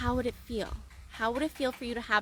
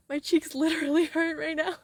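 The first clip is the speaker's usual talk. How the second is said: giggling through a smile; laughing; 7 semitones higher than usual. Real, not acted. Coach laughing about her cheeks hurting from smiling. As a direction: laugh high and giddy. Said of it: "your cheeks hurt because you're smiling so hard".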